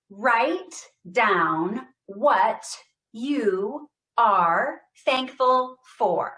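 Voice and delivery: projected therapy voice; projected up and over. Shown as a demonstration. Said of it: "saying every single word with intention".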